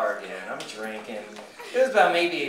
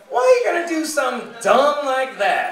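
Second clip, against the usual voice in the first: much higher, scolding desk lady; 10 semitones higher, 4 dB louder than usual. Acted. Desk lady to the man who hurt his thumb, scolding. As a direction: scold him in a high-pitched lady's voice. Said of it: "And she goes".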